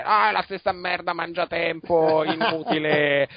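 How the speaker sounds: put-on Roman accent, ranting about wasted time; exaggerated Roman-accented complaint. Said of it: "fa il verso a Calcaterra facendo l'accento romano".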